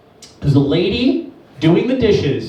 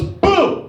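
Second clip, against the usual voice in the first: high sudden boo; mock boo; 9 semitones higher than usual. Girlfriend sneaks up, high mock boo.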